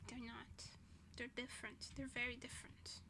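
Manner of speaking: soft whisper, still indignant; whispered insistence. Real, not acted. Insisting to herself, whispering and sulky. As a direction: hiss it quietly to yourself. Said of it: "I need to calm down".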